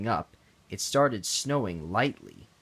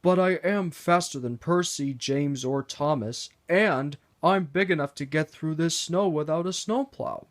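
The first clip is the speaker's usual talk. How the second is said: a lightly voiced proud engine, higher; boastful Henry; 6 semitones higher than usual. Henry boasting to Sir Topham Hatt, lightly proud. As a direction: brag about your speed. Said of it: "Henry quickly changed his mind".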